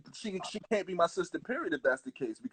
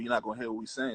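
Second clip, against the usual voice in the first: usual speaking voice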